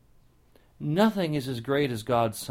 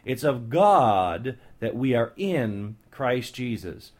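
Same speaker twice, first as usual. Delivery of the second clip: "It's of God that we are in Christ Jesus" slow, with God punched; slow down and hit God; stress on God; 4 dB louder, slower than usual. Shown as a demonstration. The preacher stresses God to his listeners, slowly. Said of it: "stressing God".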